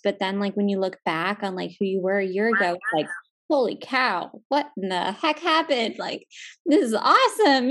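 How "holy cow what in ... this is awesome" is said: acted-out thrilled amazement; amazed excitement. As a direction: marvel at your own progress, pitch jumping up. Looking back, amazed at own change.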